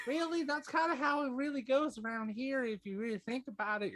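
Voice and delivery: very high, fast little satyr. Acted. Little satyr Zontan greeting everybody, high and fast. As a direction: squeak it out like a small satyr.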